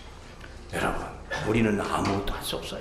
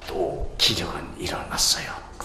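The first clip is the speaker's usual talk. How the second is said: slow, hushed whisper; awed whisper; 6 semitones lower, 3 dB quieter, slower than usual. Retold or imitated. Caller telling the pastor of a miracle, slow whisper. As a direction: let the miracle sink in softly.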